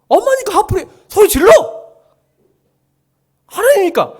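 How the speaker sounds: high, loud rallying shout; urging shout. Acted. Preacher urging the church to yell at God, loud and high. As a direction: cry it out like a command to yell.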